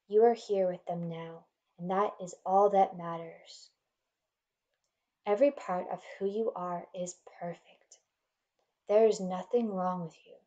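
normal talking voice

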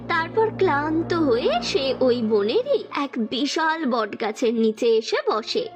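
usual speaking voice

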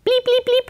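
high electronic bleep bleep; beeping sound. Retold or imitated. Explaining the remote to the audience, high bleep imitation. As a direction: mimic the remote's beep, high and quick.

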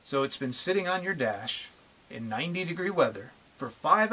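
emphatic phrases with long pauses between; pointed, spaced-out challenge; slow, deliberate. Real, not acted. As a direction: hammer each detail home for idiots. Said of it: "as slowly as I can so that these idiots can hear it".

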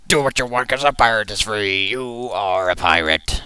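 loud, hammy pirate talk; playful pirate pep talk. Pretend. Continues pirate bit.

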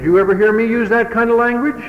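stern father. Retold or imitated. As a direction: corner the boy with the question.

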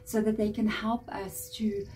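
soft, unhurried meditation voice; guided meditation. Acted. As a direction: guide the listener gently into relaxation, pausing between phrases.

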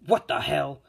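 loud, startled exclamation; acted indignant shock; shocked outburst. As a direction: blurt it out at a baffling sight. Endeavor sees pen drawing on picture.

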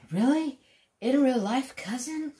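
high, rising mock disbelief; surprised disbelief. Viewers asking in disbelief about his famous cousin, high and rising. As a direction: ask it as an amazed fan. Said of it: "They say".

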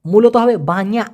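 "বাña" with a clear palatal ny, ba-nya; correct pronunciation. Shown as a demonstration. Teacher correcting learners, careful "বাña" pronunciation. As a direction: say "বাña" as ba-nya, not ba-ni-yak. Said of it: "please একটু শুদ্ধভাবে উচ্চারণ করেন".

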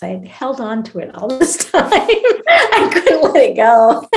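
laughing confession. Real, not acted. Admitting she clung to her ex's clothing, laughing. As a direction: break up laughing as you admit it. Laughs at own clinging to keepsake.

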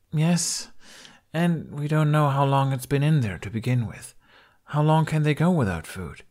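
put-on a young warrior woman asking anxiously; Kamikaze's voice. A narrator's reading. Kamikaze's lines voiced higher.

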